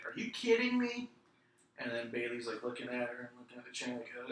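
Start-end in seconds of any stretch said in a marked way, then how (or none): 0.1-1.1 high-pitched incredulous quote; mock disbelief. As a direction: lift the pitch high, not the volume. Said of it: "clearly read her lips where she's all like".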